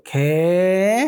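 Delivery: drawn-out, rising mock-shocked question; retold disbelief. Storyteller replaying her reaction to his deadly game, stunned disbelief. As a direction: stretch it upward as if stunned.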